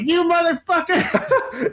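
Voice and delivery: high, slow, drawn-out curse through laughter; laughing curse. Retold or imitated. Friend cursing his prankster while laughing, mock outrage. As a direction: stretch it out high, cracking up. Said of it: "just, like, laughing the whole time".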